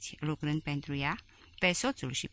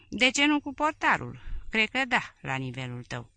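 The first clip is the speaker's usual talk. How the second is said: sour jab pitched high and louder; 5 semitones higher, 4 dB louder than usual. A narrator's reading. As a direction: sneer it, high and cutting. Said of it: "a intervenit Elena cu acreală".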